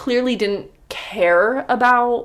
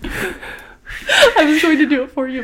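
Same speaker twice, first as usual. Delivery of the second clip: laughing; 5 semitones higher, slower than usual. Real, not acted. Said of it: "I was laughing too".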